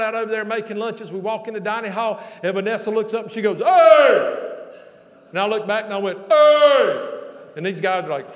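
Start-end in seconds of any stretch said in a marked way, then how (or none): 3.6-4.5 very loud, high imitated bellow; hollered greeting. Preacher imitates Vanessa greeting him before donors, bellowing. 6.3-7.1 very loud, high bellow back; hollered reply. Retold or imitated. Preacher hollers back at Vanessa before donors, loud call.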